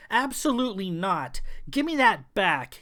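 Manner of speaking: mild roar, snatching back what's his. Acted. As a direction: hero roars for the stolen rose. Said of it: "he roared".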